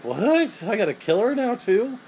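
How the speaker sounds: high-pitched disbelieving caricature; mock incredulous protest. Touched man balks at the friends' order, high mock disbelief. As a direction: question it in a put-on high voice. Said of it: "And he's like".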